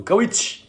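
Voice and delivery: "كاوتش" said kaa-wutch with a high rising pitch, mocking a wrong English "couch"; mock mispronunciation. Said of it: "الإنجليزي بتاعه على قده".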